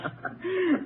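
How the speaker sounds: a quick chuckle at his nerves; amused laugh. Acted. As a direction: laugh at the nervous sergeant. Scoffing at the sergeant's nerves.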